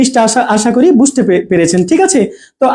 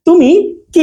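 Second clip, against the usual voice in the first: "তুমি", gap, then a higher "কি"; space before কি; 4 semitones higher, slower than usual. Shown as a demonstration. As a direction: separate "তুমি" from a weighted "কি". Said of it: "একটু space দিচ্ছি". ("তুমি"-র পরে একটু থেমে "কি")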